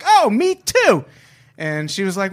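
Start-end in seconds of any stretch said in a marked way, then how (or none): 0.0-1.1 high, loud, drawn-out eagerness; feigned excitement. Retold or imitated. Man flirting with a Hooters waitress, imitated over-eager excitement. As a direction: burst out slowly with put-on delight. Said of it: "I was like".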